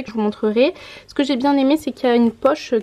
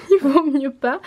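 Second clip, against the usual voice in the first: amused, laughing through the words; 3 semitones higher than usual. Real, not acted. Vlogger laughs off her own English accent, laughing. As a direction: say it through a self-mocking laugh.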